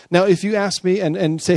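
ordinary talk

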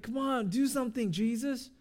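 high-pitched, acted urgent pleading; urgent plea. Panicking disciples to Jesus asleep on the ship, urgent plea. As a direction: plead upward as if begging someone to act. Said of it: "and then they're like".

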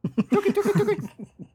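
high, loud chirpy tittering laugh; chirping bird laugh. Real, not acted.

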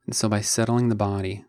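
unhurried meditation guide with long pauses; measured, calm instruction, leaving space between phrases; guided meditation. Acted. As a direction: guide listeners gently into the body, phrase by phrase.

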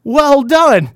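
high, loud mock praise from dad; hammed-up fatherly cheer; mock proud father. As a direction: play the smug father congratulating his son. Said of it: "his father's like".

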